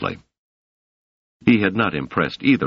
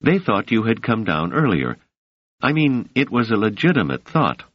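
lightly raised voice for Doris, calm; a hint of the young woman, measured and soothing; 7 semitones higher than usual. Doris explains the men's mistake to Selden: lightly raised, calm.

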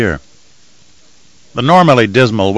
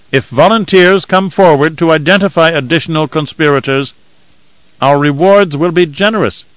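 higher-pitched voice of an official announcement; loudspeaker proclamation; 7 semitones higher than usual. A narrator's reading. Occupiers' official announcing punishment to the populace, raised proclaiming voice. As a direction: pronounce the punishment as over public speakers. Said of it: "The voice from the speakers crackled out".

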